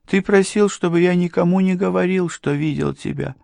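high-pitched madman recalling a meeting; Morgan, simple-minded madman. A narrator's reading. Madman recalls being left in the wagon, high-pitched.

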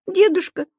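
a high, thin little squeak; frightened girl squeaking at the old man in a stuck lift. A narrator's reading. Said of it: "пискнула девочка".

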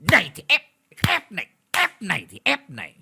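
loud, high mocking yell with each slap; vicious taunting. Landlord slaps the old woman, jeering viciously. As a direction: jeer in time with the blows.

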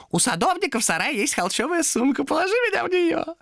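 very high creature voice; insistent demon. Acted. Demon orders Томас to bag it, insistent. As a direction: press him with a high, pushy order.

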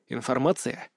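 plain reading voice

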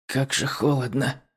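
teeth chattering from cold; shivering, teeth rattling; skeleton. A narrator's reading. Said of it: "стуча зубами". (стуча зубами от холода)

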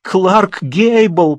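high, drawn-out moan on «Гейбл». A narrator's reading. Unhappy Lidka moans the name to Beletsky, high drawn-out moan. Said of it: "простонала несчастная Лидка".

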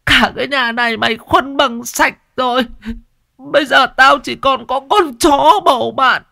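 high, loud sobbing lament; half crying. Acted. Old mother laments son sold everything, high and loud, crying. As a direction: plead through tears. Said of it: "nói như khóc".

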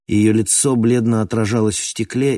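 usual speaking voice

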